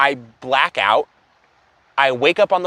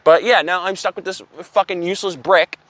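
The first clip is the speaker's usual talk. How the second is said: ordinary talk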